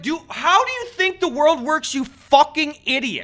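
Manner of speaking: high, loud disbelieving rant; exasperated yell. Retold or imitated. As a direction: blow up at the customer. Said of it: "It's like".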